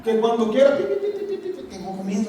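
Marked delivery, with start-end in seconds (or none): 0.8-1.3 high squeaky beeping; antenna beeps. Retold or imitated. Preacher imitates grasshopper antennae, high mock beeps. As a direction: beep like a little alarm.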